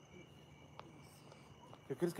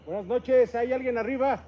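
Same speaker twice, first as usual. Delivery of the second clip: calling out; 6 semitones higher, 8 dB louder than usual. Real, not acted. Calling out to a spirit.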